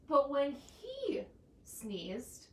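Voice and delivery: ordinary delivery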